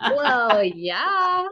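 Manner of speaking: drawn-out Dakota "well, yah"; hammed-up North Dakota accent. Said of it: "We gotta have our Dakota accent here".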